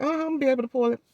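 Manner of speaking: high-pitched imitation of the dentist; chirpy dentist reply. Dentist assuring patient about pulling it, chirpy imitation.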